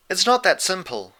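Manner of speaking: a calm ruler correcting them; lightly voiced regal princess. A narrator's reading. Celestia gently refusing the friends, lightly regal.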